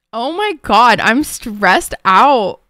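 loud, very slow stressed wail; loud drawn-out exasperation; stressed outburst. Real, not acted. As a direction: drag out the stress. Streamer panicking about ban.

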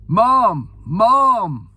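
high, loud call across the crowd; retold shout. Racegoer calling to the Queen Mother over polite clapping, shouted. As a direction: holler it out to get her attention. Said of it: "I shouted".

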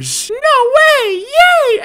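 high, loud squealed cheering; mock celebration. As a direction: cheer in an over-the-top high voice. Celebrates friends recognising him.

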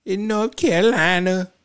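high mocking imitation of her fake Southern accent. Commentator mocking vice president's fake accent, high sarcastic imitation. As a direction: ham up a put-on North Carolina twang, mocking. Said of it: "Here she go with that fake accent".

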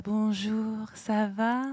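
a hint of syrupy politeness; sugary sweet greeting. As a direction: imitate a falsely sweet sister. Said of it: "parler comme ça".

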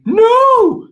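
high, loud, drawn-out cry; protesting pupils. Retold or imitated. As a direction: imitate kids shouting down a mistake. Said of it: "And they're like".

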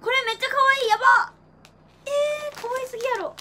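high, loud gushing delight; excited squeal. Real, not acted. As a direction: squeal over something cute. Gushing over unboxed goods.

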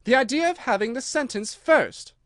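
high, indignant exclamation; scornful girl's objection; loud protest. A narrator's reading. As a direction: protest at the absurdity, loudly. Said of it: "said Alice loudly".

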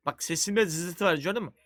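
ordinary talk, nothing marked

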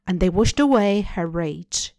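ordinary delivery, nothing marked

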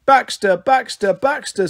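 put-on high toddler call, fading; small child chanting a name. A narrator's reading. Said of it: "said Pixie".

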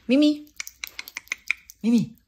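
high call to the cat. Real, not acted. Owner calling her cat across the room, high call.

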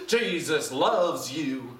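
slow, drawn-out evangelist impression; badly faked famous preacher, stretching each word; Billy Graham. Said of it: "My Billy Graham".

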